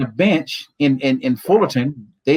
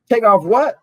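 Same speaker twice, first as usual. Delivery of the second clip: higher-pitched, incredulous echo; retold taken-aback reaction, pitched up; startled question; 7 semitones higher than usual. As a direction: question the order in mock alarm. Startled reply to undressing order.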